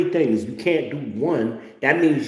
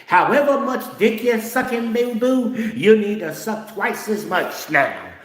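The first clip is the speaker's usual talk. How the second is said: Cat Williams impression; 9 semitones higher than usual. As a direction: go way up high, punchy like the comedian. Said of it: "That was my Cat Williams impression".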